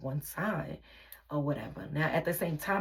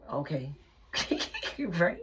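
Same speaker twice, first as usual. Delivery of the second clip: real laughing; 4 semitones higher than usual. Real, not acted. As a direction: chuckle through the words. Laughing over his denial of paternity.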